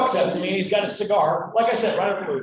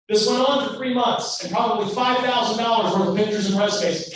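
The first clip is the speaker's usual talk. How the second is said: ordinary delivery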